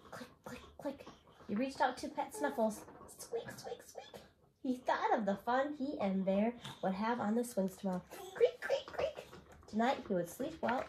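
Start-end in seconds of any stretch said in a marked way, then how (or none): 0.1-1.1 whispered clicks. Acted. Sound effect of lamp. 3.2-4.2 squeaky whisper. Acted. Squeaky pet noise. 8.3-9.3 creaking sound. Acted. Creaking house noise.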